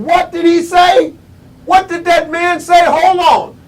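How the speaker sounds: high, loud disbelief; astonished outcry. Acted. Speaker reacting to Mandela's answer, loudly incredulous. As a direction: call it out as if stunned by what you heard.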